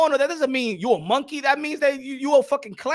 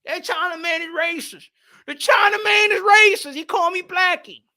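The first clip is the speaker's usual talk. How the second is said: mock outrage; 6 semitones higher, 4 dB louder than usual. Offended customer reporting being called Blackie, mock outraged wail. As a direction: cry out the accusation, hammed-up.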